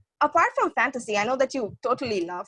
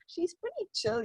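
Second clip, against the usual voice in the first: softer, higher voice of a calming friend; imitated reassurance; 6 semitones higher, 6 dB quieter than usual. Friends telling a nervous interviewer not to worry, imitated reassurance. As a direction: quote a friend's light, soft reassurance.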